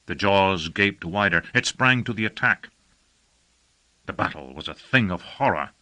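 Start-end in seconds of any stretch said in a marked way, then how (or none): none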